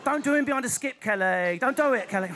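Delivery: Essex accent with stretched vowels. Shown as a demonstration. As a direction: draw out the vowels in mock Essex. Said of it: "the more pikey your accent is".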